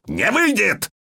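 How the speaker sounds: high-pitched dwarf's bark; mildly roared, pitch jumping up; barked refusal. A narrator's reading. Dwarf refusing the witcher's neutrality, barking. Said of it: "рявкнул Ярпан".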